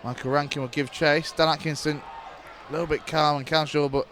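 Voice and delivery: steady radio match commentary; live play-by-play. Acted. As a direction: call the match as it unfolds.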